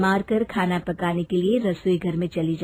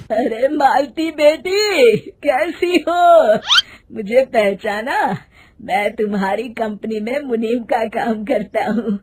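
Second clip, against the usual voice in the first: put-on old man; 6 dB louder than usual. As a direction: call out to her like an elderly clerk. Old man greets Malti in market.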